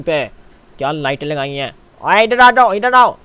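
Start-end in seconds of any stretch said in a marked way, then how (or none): 2.0-3.2 loud, high-pitched summons; calling over. Pretend. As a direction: holler for someone to come here. (ज़ोर से पास बुलाना)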